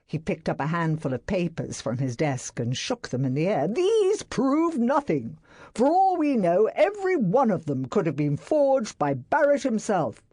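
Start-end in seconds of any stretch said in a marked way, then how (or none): 3.7-10.2 high, louder angry dismissal. A narrator's reading. Employer dismissing papers to secretary, angry. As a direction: shake them off as forgeries. Astley shaking papers angrily.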